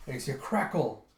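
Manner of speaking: normal talking voice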